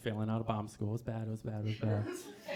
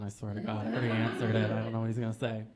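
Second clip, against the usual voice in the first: normal talking voice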